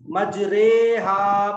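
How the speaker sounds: मजरेहा with 'ray' as in sitare; raa as in sitare. Shown as a demonstration. Teacher comparing it to Urdu sitare, मजरेहा with long 'ray'. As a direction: draw out a loud 'ray' in मजरेहा. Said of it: "सितारे की रा की तरह पढ़ेंगे".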